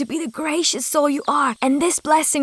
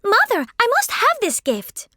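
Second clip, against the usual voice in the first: higher, insistent and grasping; greedy demand; 7 semitones higher than usual. Acted. Sophie begs her mother for the gift, high and insistent. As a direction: demand it like a spoiled child.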